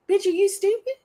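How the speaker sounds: very high-pitched, scandalized disbelief; incredulous scolding. Pretend. Viewer berating a show character, incredulous. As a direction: tell off a character in a high squeal.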